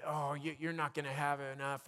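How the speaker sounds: a hushed, low voice of worry; soft, low nagging voice of worry. Retold or imitated. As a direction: let worry nag quietly in the ear. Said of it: "a little voice called worry".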